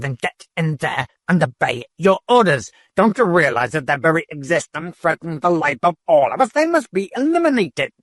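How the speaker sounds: exploding in anger. A narrator's reading. Eldest keeper exploding at the disobedient robot. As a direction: erupt at the robot, pitch climbing. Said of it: "the eldest keeper exploded".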